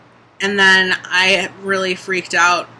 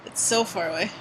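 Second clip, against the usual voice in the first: ordinary talk, nothing marked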